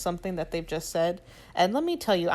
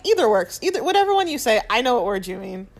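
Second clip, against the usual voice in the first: flustered reply; 4 semitones higher, 6 dB louder than usual. Retold or imitated. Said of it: "I was like".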